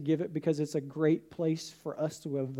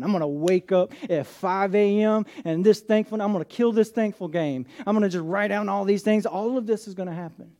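louder, pumped-up mock resolve; preaching at full force; 4 semitones higher, 7 dB louder than usual. Preacher mocks willpower to the church, loud and pumped. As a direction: pastor acts out striving to earn thankfulness, fired up.

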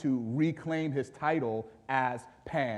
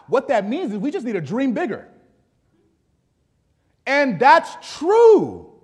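loud, high preaching voice; emphatic pulpit delivery; forceful preaching; 7 semitones higher, 12 dB louder than usual. Real, not acted. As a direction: preach it out, landing hard on the last words.

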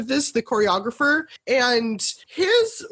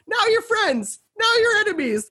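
mock dramatic; 10 semitones higher than usual. Mocking shifting emotions.

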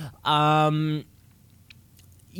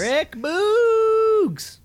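high excited shout of a name; hype cheer; 4 dB louder than usual. Acted. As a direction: cheer it like a wrestling fan.

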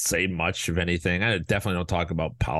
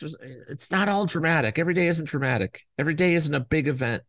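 ordinary delivery

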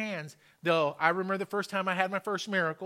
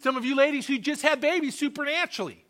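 raised, higher-pitched sermon voice; fervent preaching; 6 semitones higher, 5 dB louder than usual. Real, not acted. Preacher urging congregation to testify of miracles, fervent preaching. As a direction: drive it home to the congregation with force.

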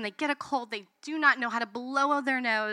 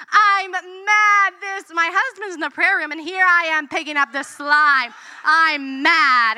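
loud, high frustrated outburst; angry rant; 6 semitones higher, 9 dB louder than usual. Retold or imitated. Mom cleaning up toddler's slime while husband prays, loud high angry rant. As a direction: vent it hot and loud.

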